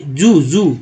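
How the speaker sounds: जू as one syllable, "zoo", said twice; correct word reading. Shown as a demonstration. Teacher answers how it should be read, as a blended word. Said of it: "पढ़ते हैं".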